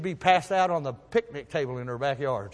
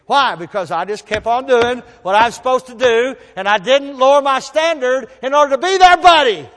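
louder, higher preaching build; 6 semitones higher, 9 dB louder than usual. Acted. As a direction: drive the point home from the pulpit. Sermon rises to full force.

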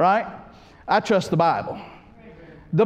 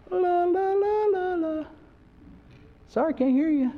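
mocking singsong; 10 semitones higher than usual. Ignoring God, singsong.

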